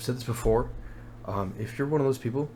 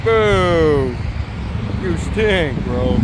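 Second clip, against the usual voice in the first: mock booing; 7 semitones higher, 12 dB louder, much slower than usual. Real, not acted. Crowd boos slow car.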